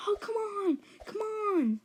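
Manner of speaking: high-pitched imitated group complaint; mock whiny protest. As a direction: plead like put-out guests. Party guests complain when evicted.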